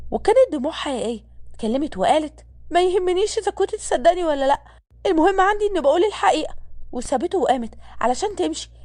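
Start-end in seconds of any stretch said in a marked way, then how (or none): 2.7-6.5 put-on higher-pitched young woman's voice, mildly upset; upset, close to tears. A narrator's reading. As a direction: voice her hurt protest in a higher pitch. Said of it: "عينيها دمعت بضيق". (بضيق ودموع، بصوت أعلى نبرة)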